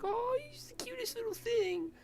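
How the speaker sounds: high-pitched cooing over a cat; cutesy baby talk. Retold or imitated. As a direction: squeal like a cat lover seeing a kitten. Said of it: "that's the kind of demeanor he switches to".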